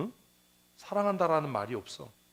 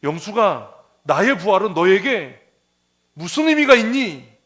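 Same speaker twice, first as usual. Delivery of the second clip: louder, slow and higher at the end; loud, slow calling question from Jesus; 3 semitones higher, 11 dB louder, slower than usual. Retold or imitated. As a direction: call out a gentle but insistent question. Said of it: "예수님께서 지금 오신다면".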